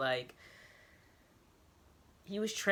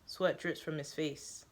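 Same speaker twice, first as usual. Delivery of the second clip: plain reading voice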